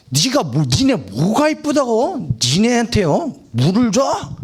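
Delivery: hammed-up indignation, pitched high; angry Moses. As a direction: throw it at them as an outraged rhetorical question. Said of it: "성을 화를 냈다는 거예요".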